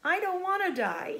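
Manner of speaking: high, loud moan. Acted. Wilbur moaning to the barn, high. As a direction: wail it out. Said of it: "he moaned".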